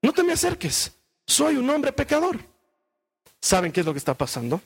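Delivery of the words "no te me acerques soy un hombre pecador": high-pitched mock panic; hammed-up panic, pitched high; acted panic. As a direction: push him away in a raised, panicky pitch. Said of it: "entra en pánico y le dice".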